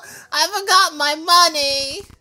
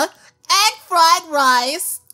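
slow reveal; 3 semitones higher, 4 dB louder, much slower than usual. Real, not acted. Grand reveal of the food.

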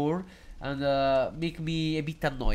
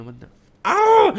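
sudden yelp; 10 dB louder, slower than usual. Real, not acted. Cursing mid-reading, cries out.

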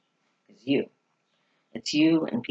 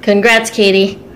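higher and louder, calling from across the room; called-out congrats; 7 semitones higher, 12 dB louder than usual. Real, not acted. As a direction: shout it over to her cheerfully. Calling out from the background.

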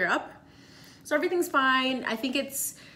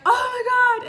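high, loud shocked cry; shocked gasp; 12 semitones higher, 7 dB louder than usual. Retold or imitated. Friend rips the detector off, she cries out in shock. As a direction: shriek it in shock.

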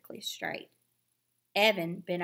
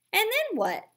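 much higher, mocking question; taunting; 6 dB louder than usual. A narrator's reading. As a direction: needle her with a sneer. Evan taunting sister.

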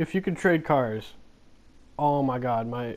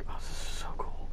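awed whisper; 7 dB quieter than usual. Real, not acted. Awed at the game building.

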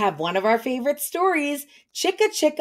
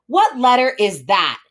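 ordinary delivery

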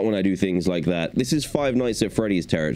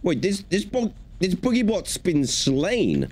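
surprised gasp; 10 semitones higher than usual. Real, not acted. Shocked at the slain robot.

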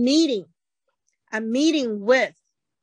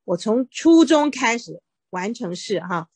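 ordinary delivery

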